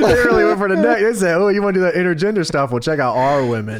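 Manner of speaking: talking through laughter, high and fast; laughing. Real, not acted. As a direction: laugh out the words. Laughing, mock quote.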